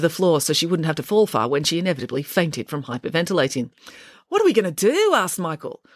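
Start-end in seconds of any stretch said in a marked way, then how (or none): 4.3-5.1 panicked. Acted. Panicked child's question.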